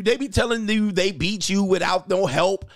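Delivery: ordinary talk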